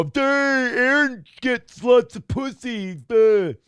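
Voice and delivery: high, dopey Twitter mocking voice; funny voice. Host imitates people on Twitter, silly mocking voice. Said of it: "do the funny voice criticism thing".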